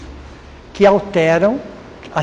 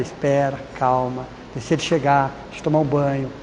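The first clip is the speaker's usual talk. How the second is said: softer, calm and reassuring; calm advice; 6 semitones lower, 7 dB quieter than usual. Retold or imitated. Counsellor calming a worker's wife, softer and reassuring. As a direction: ease her down gently, softer voice.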